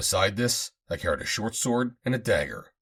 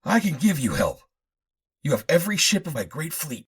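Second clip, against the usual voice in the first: higher pleading offer; 9 semitones higher, 4 dB louder than usual. Acted. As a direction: plead and bargain. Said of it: "in a pleading tone".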